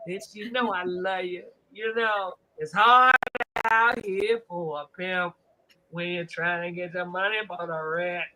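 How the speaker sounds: low, soft, half-laughing pimp plea; mock pimp plea. Host mimics a pimp sweet-talking for rent money, low.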